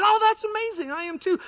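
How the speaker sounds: high, loud delighted cry; overjoyed. Retold or imitated. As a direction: squeal with delight at the match. Overjoyed man in joke.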